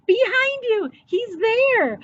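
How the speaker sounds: frightened cry. A narrator's reading. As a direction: cry out in fright as a small boy. Frightened boy warns mom.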